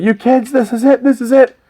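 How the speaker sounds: high-pitched mock yell at fleeing kids; grumpy neighbour yelling. Mister McCall yells at the kids, high mock shout. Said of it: "he's like".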